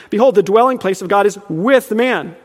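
"with" set apart and punched; stress on WITH. Shown as a demonstration. Preacher rereading the verse for emphasis, stress on "with". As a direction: lean hard on the word "with". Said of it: "for emphasis".

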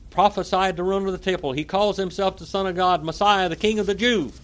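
insistent accusation. A narrator's reading. Crowd accusing Jesus before Pilate, insistent. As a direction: pile up the charges insistently.

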